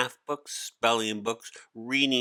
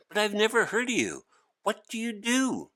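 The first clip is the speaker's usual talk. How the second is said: much higher and fast curious questions; eager boy Hari; 13 semitones higher, faster than usual. Acted. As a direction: rush the questions out excitedly.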